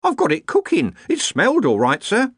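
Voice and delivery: high, anxious servant woman; panicked cook. A narrator's reading. The cook defends her fish to officials, panicked. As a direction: protest up high in a flustered state.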